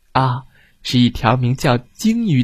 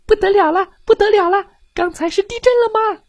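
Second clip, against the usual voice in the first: much higher squeaky fish voice; tiny panicked fish; faster than usual. Acted. Goldfish crying out to each other, high panicked squeak. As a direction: squeal it like a frightened little fish.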